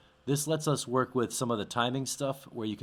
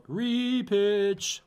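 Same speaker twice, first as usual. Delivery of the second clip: high-pitched; 11 semitones higher, 4 dB louder than usual. Acted. Announcing favorite repitch switch.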